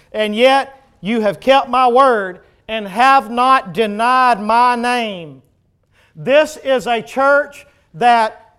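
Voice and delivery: loud, emphatic sermon declaration; full-force preaching. Real, not acted. Preacher declares the church's virtues to congregation, full-force preaching. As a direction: proclaim it with weighty emphasis.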